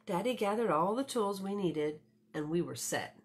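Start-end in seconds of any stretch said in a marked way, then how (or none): none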